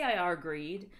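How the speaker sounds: ordinary delivery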